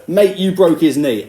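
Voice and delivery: imitating a coach squaring up to him; slow, pushy accusation; aggressive tone. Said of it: "that sort of aggressive tone".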